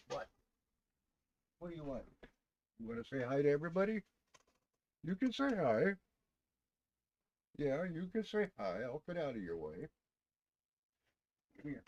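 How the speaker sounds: high, soft pet voice. Real, not acted. Streamer coaxing his cat to greet viewers, high soft baby talk. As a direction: coo at the kitty to come say hi.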